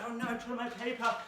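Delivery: startled high yelp of dismay; oops. Real, not acted. Teacher notices her paper hurdle has dropped, startled. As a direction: jump up in pitch at the mishap.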